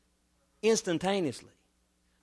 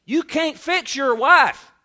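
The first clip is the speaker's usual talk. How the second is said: emphatic preaching; 6 semitones higher, 7 dB louder than usual. Real, not acted.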